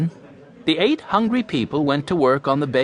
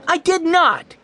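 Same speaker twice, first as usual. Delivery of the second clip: high, louder shouted denial; indignant shout; 5 dB louder, slower than usual. A narrator's reading. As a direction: shout it back in outrage. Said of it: "he shouted".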